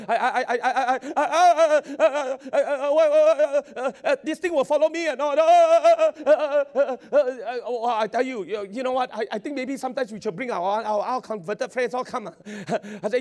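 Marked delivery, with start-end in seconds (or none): 0.1-7.6 high, loud laughter breaking through stuttered words; stammering laugh. Real, not acted. Preacher cracking up at his own story, laughing. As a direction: laugh so hard the words stick.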